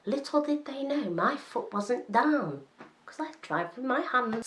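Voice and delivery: high, sing-song mock reveal; playful knowing aside. Driver lets viewers in on her hand controls, playful high aside. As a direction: lift the pitch for a cheeky reveal.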